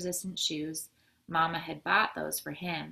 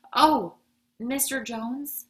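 loud high "oh", then drawn-out name; dismayed outburst; 6 semitones higher, 10 dB louder, slower than usual. A narrator's reading. Hyacinth suddenly remembers the move, dismayed outburst. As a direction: burst out as bad news comes back.

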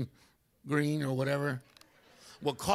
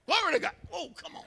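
excited praise; 12 semitones higher, faster than usual. Real, not acted. Preaching praise, crowd response.

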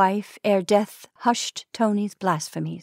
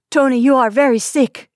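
higher, louder plea from a timid wife; worried wife; 5 semitones higher, 6 dB louder than usual. Acted. Wife to her sick husband, anxious and pleading. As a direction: tell him gently but urgently he is ill.

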